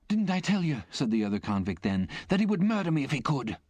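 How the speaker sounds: a high, frightened appeal to the soldiers; the cowed convict crying that he'd be murdered; shaking with fear. A narrator's reading. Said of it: "he shook with fear".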